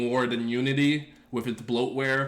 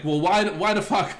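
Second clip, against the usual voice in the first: higher and louder, throwing up his hands at the docs; exasperated outburst; 6 semitones higher, 5 dB louder than usual. Retold or imitated. Streamer mock-ranting to chat about confusing docs, exasperated. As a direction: vent the frustration with a mock outburst. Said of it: "And it's like".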